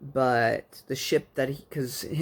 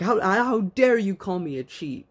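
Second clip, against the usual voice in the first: higher, louder outraged retort; indignant Han; 7 semitones higher, 4 dB louder than usual. Retold or imitated. Han snapping back at Lando, mock outraged and higher. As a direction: bristle as if insulted. Han offended in sabacc argument.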